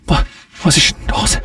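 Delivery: frightened high whisper; whispered fear. Acted. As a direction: whisper it, startled and scared. Woken at night by noise.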